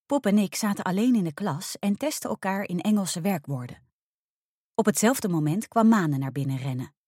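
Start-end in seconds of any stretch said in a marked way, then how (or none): none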